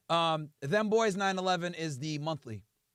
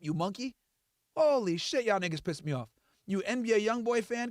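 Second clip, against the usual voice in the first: ordinary delivery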